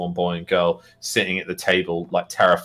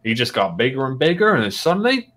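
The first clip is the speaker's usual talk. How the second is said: gruff orc farmer; 5 semitones higher, 3 dB louder than usual. Acted. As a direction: recount grim news as a farmer orc.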